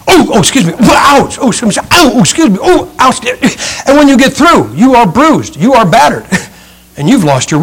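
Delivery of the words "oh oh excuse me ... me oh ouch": mock pained oh-excuse-me's, pitched up; people bumping in the dark. Preacher mimics hikers colliding in the dark tunnel, mock pained yelps. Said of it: "all you hear is this".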